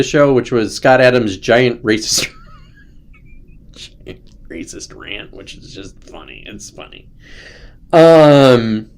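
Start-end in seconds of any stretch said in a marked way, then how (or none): 3.0-7.1 laughing. Real, not acted. Laughs at his own description.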